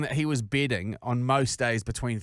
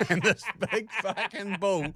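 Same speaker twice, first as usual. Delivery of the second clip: laughing; 8 semitones higher, slower than usual. Real, not acted. Laughing through the punchline.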